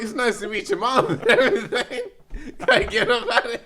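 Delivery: high, loud, laughing all through; retold politeness drowned in real laughter. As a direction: barely get the quote out for laughing. Laughing through retold awkward meeting.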